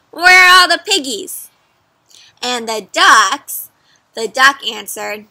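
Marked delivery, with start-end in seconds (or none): 0.1-1.5 higher, louder witch question; a pushy witch demanding an answer; witch asking. Acted. Witch asking the duck where the piggies went, higher and louder. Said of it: "So the witch asked".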